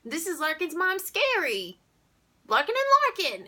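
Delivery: high, loud cartoon announcer of guests; hammed-up, hollered introductions; the Wolf. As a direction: introduce them like an excited clown. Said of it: "like a human cartoon called the Wolf".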